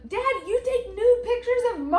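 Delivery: put-on high, loud child's blurted question; imitating child. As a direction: blurt it out like a kid. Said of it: "and Henny's like".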